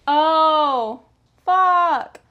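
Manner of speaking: loud, drawn-out exasperated curse; frustrated swearing. Real, not acted. Sewer cursing a snapped thread, loud and exasperated.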